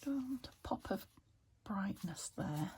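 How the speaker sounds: ordinary talk, nothing marked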